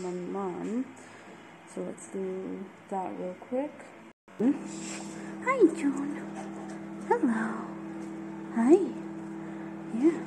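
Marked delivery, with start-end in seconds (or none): 4.4-10.2 high, bright greeting to a dog; pet voice. Real, not acted. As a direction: coo hello to the dog.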